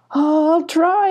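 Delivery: high, tearful reply; crying. A narrator's reading. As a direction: answer through tears, pitched high. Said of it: "answered Abby, crying".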